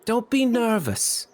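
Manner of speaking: put-on very high child voice; little boy. Little boy encourages nervous Ophelia, high child's voice. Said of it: "The little boy looks up at you".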